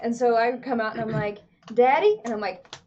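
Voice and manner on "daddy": a retold Southern "Daddy", higher and much louder; murder-mystery guest, mock Southern "Daddy"; Southern accent. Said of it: "committed myself to an accent".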